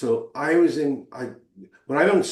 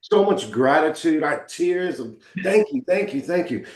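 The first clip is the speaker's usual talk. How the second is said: tearful thanks; 5 semitones higher than usual. Retold or imitated. Patient thanking his nurses, a hint of tears. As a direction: relive the grateful tears lightly. Recalling tearful gratitude after surgery.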